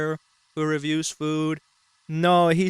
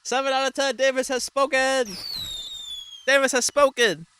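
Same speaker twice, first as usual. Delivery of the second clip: mock proclamation; 8 semitones higher, 4 dB louder than usual. Real, not acted. Proclaiming the reviewer's verdict.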